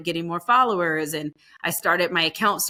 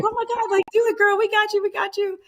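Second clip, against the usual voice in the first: much higher, squealing hype voice; parents cheering her on; faster than usual. Retold or imitated. Mom and dad hyping their daughter to go compete, in a much higher squeal. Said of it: "so they were just like".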